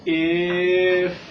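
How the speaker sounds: loud, long-held sounds; slow model. Shown as a demonstration. Teacher says listen to a new word, slow and sustained. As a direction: stretch "If" right out, holding each sound. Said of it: "I will say it slow".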